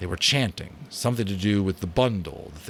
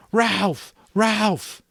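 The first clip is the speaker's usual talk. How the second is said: high, loud cry of pain; 7 dB louder than usual. A narrator's reading. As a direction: call out as if hurt. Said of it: "Simon cried out as though he had hurt himself".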